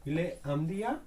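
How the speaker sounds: "indien" gliding steadily upward to the end; rising question tone. Shown as a demonstration. French teacher modelling a question, tone climbing at the end. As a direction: draw the pitch up through "indien" to ask. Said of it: "we are raising the tone".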